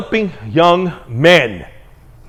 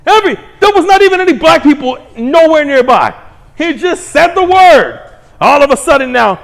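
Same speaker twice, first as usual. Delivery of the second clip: ranting; 7 semitones higher, 5 dB louder than usual. Real, not acted. Ranting about outrage.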